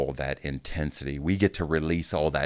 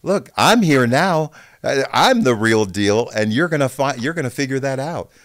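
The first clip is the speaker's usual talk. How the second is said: loud, high cocky boast; mock swagger, louder and higher; cocky quote; 8 semitones higher, 7 dB louder than usual. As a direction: brag like a swaggering rookie. Mimicking cocky veterans.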